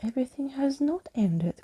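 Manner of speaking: high excited cry; high cry of relief; cried out. A narrator's reading. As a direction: call it out with hope. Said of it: "cried Skeleton Keys".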